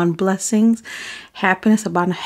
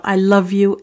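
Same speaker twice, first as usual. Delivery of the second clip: ordinary talk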